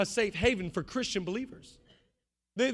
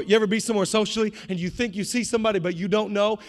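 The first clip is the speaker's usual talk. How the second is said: ordinary delivery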